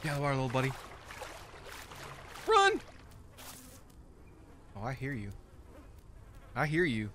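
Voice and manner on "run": sudden high shouted cry; yelling run. Real, not acted. Gamer yelling at his dino to flee. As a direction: yell it out in alarm. Fleeing a predator in game.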